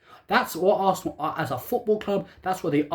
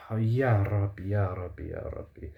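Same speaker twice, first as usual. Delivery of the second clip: fading lower and softer with each "ya Rabbi"; despairing lament; 8 semitones lower, 5 dB quieter than usual. Real, not acted. Fan despairing over Arsenal, fading lament. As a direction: sink into hopeless prayer.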